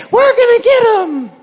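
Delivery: put-on high, loud kid cheer with a laugh; pumped-up kid yelling; excited high shout. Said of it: "you're thinking".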